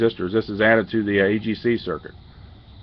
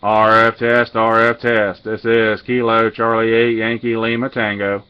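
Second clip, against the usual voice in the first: louder, deliberate on-air call sign read; radio test call; 4 dB louder, slower than usual. Acted. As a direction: announce the call sign clearly over the air.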